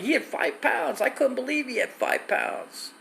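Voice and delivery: very high-pitched squeal of disbelief; mock incredulous. Retelling the shock at five pounds of dope, high incredulous voice.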